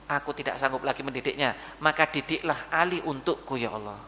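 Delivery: soft, low surrender to God; humble plea. Acted. Father asks God to teach Ali for him, softly pleading. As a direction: speak low, fading away on the last words.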